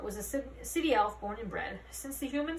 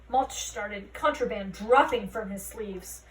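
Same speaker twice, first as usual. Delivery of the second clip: usual speaking voice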